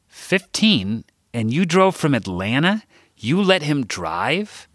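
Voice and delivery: high, incredulous questions, slowing at the end; disbelief. A narrator's reading. Sarah learning a fifteen-year-old drove, in disbelief. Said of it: "in even more disbelief".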